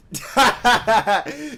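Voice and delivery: big loud burst of laughing; hearty loud laugh; loud laughter. Real, not acted. Laughing at Daryl story.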